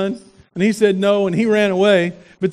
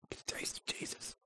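whisper; 29 dB quieter than usual. Real, not acted.

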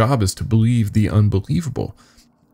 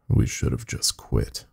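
hushed regret; 7 semitones lower, 7 dB quieter than usual. A narrator's reading. Regretful aside in story.

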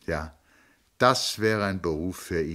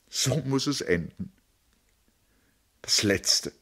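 higher grieving sister; grieving lament; 6 semitones higher, 4 dB louder than usual. Acted. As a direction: mourn a wasted life. Tony mourns her failed life.

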